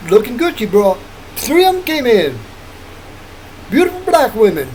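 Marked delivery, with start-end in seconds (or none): none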